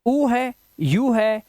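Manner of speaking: उ, यू slowly, as "oo", "you"; separate vowel sounds. Shown as a demonstration. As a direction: list उ and यू one by one. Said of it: "यूआई के उच्चारण क्या क्या".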